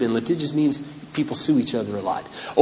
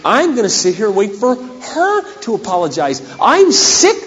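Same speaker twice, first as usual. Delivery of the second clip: indignant complaint; 8 semitones higher, 4 dB louder than usual. Retold or imitated. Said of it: "And I'd say".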